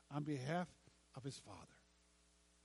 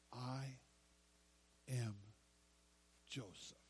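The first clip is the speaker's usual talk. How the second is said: quiet reveal; 5 semitones lower, 8 dB quieter, slower than usual. Acted.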